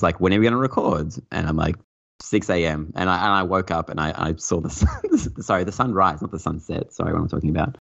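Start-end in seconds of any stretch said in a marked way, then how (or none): none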